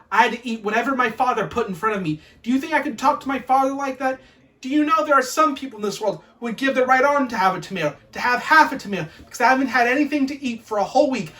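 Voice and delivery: put-on loud, fast mother telling off a child; exasperated parental tirade; scolding rant. Mother's scolding rant voiced in story.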